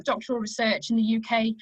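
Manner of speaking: normal talking voice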